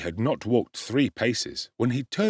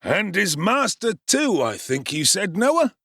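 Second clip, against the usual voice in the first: anxious beadle; 9 semitones higher, 4 dB louder than usual. Acted. Beadle asking Noah.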